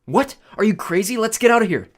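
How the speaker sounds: high, loud incredulous panic; alarmed disbelief. A narrator's reading. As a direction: refuse and urge escape. Alarmed refusal in argument.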